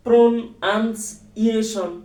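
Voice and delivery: "প্রন আনস ইয়েশন" in three detached chunks, pron, ans, i-e-shon; wrongly split word. Shown as a demonstration. Teacher trying a learner's own splitting, three detached chunks. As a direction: chop "প্রন আনস ইয়েশন" into evenly pitched pieces with pauses. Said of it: "এভাবে মু-, করেন উচ্চারণ".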